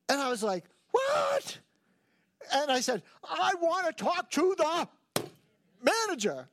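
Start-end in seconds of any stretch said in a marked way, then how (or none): 0.9-1.6 shocked what. Retold or imitated. Shocked reaction to the teller. 3.2-6.5 puffy and huffy. Retold or imitated. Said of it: "I get all puffy and huffy".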